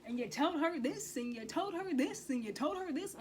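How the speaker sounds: put-on reading his messages aloud to boyfriend, accusing; accusing readout. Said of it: "I'm just reading it all".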